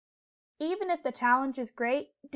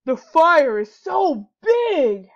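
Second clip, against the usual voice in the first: loud, slow, drawn-out howl; a wolf wailing at the huge fire; 4 semitones higher, 12 dB louder, much slower than usual. A narrator's reading. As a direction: stretch each word into a loud howl. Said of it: "howled Wolf".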